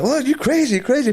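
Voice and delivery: high, loud imitation of her denial; mock indignant woman. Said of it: "And she's like".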